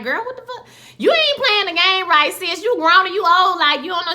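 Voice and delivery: put-on high, loud, sassy kid voice. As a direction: talk down to a grown woman like a bratty child. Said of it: "the kids is looking at her like".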